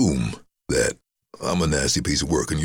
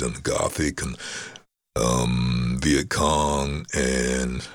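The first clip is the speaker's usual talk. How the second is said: ordinary talk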